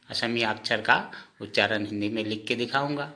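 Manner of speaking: ordinary talk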